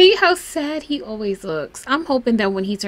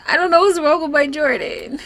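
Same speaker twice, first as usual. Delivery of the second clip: normal talking voice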